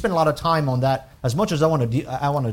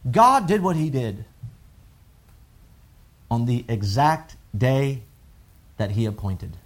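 normal talking voice

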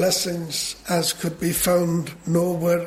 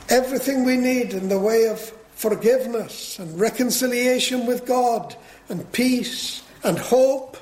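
high, sustained pulpit delivery; fervent preaching; 7 semitones higher than usual. Real, not acted. Preacher listing what God supplies for sinners, fervently proclaimed. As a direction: proclaim each item in a raised preaching voice.

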